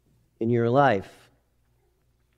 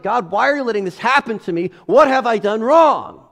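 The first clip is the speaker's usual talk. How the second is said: higher, louder anguished plea; complaining to God; 7 semitones higher, 6 dB louder than usual. Retold or imitated. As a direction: cry out to God in protest. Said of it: "He's like".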